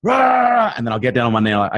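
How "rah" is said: high, loud wordless roar; imitated yell. Dad re-enacting yelling at home, mock roar. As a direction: burst out with a mock furious yell. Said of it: "If I ever yell in my house".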